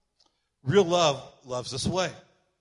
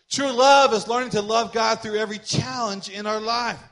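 full-force preaching; 5 semitones higher, 9 dB louder than usual. Acted. Pastor to congregation, preaching at full force. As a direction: preach it out to the room.

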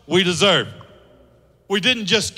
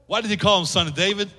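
ordinary talk, nothing marked

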